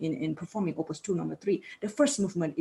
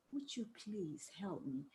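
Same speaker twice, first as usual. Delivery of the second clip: slower, softer, drawn-out plea; slow tender plea; 16 dB quieter, slower than usual. Shown as a demonstration. Teacher showing students a persuasive slow request, tender. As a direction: linger on it, persuading. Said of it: "It means much more than".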